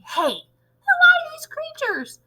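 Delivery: very high screech of a frantic teacher; demented yelling. A narrator's reading. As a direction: witch mimicking teachers' shrieked alarm. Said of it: "demented teachers is yelling".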